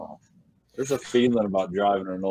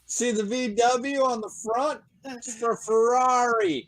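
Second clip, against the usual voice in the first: joking mock exchange; 11 semitones higher than usual. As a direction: play a clueless know-it-all.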